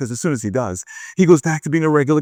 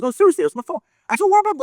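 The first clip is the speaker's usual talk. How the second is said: high, urgent protest; excited insistence; much faster than usual. Acted. Man phones best friend about meeting his idol, excitedly insistent. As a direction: climb higher as you insist it's true.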